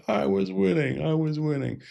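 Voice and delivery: put-on a spoilt boy dragged off mid-game, complaining; whiny protest. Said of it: "still protesting".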